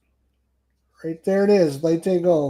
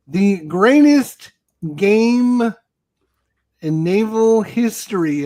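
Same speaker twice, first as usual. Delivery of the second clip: dramatic build-up; 5 semitones higher, 4 dB louder, slower than usual. Real, not acted. Hyping up the big reveal.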